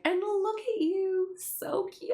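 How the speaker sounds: high, slow cooing voice; cooing Pim. Retold or imitated. Reading Pim teasing Alvin in a high, slow coo. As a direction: coo it like a smug flirt. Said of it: "And then Pim's like".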